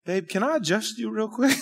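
soft, sweet imitation of wife; kindly. Wife asking husband to let her adjust him, kindly. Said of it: "she very kindly says".

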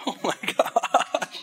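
slow breathless laughing gasp; wheezing laugh. Real, not acted. Wheezing laugh after burning bite.

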